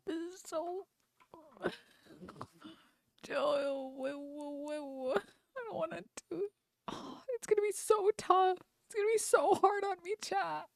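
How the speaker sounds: high, soft, half-hearted fake sob; playful teary whine to chat; mock whimpering. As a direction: whine like it's too much to bear. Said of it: "I'm gonna cry".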